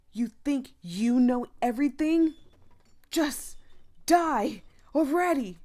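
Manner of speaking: loud, high sneering jeer; cruel bully taunt. Acted. Bully jeering at Milo, loud and cruel. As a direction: spit out the final insult.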